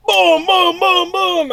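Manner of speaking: high, loud string of booming impacts; rapid punch noises. Retold or imitated. Storyteller acting out stuntman attacking outside the door, booming blows. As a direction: fire off punches as sound effects.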